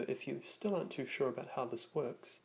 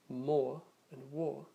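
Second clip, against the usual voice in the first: British "more and war" with long or vowel and silent r; British style accent; 3 dB louder, slower than usual. Shown as a demonstration. Teacher matching vowels in a British style accent, British r-less "more and war". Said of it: "in a British style accent".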